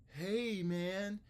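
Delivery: high greeting call; teacher voice. Shown as a demonstration. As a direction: model the phrase for a student. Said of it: "if that wasn't clear".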